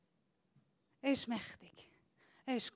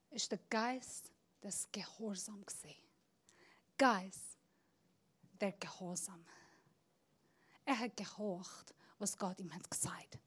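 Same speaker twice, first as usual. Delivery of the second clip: softer, unvoiced whisper; 6 semitones lower, 12 dB quieter than usual. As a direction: draw them close with a whisper. Whispered teaching on obedience. (leise geflüstert)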